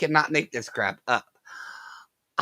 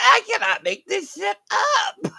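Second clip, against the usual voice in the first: laughing disbelief. Real, not acted.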